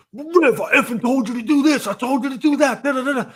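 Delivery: high, loud mock rant; mocking angry Blaze. Friend imitating Blaze's gaming rant, high and loud. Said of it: "you would blame everything on me".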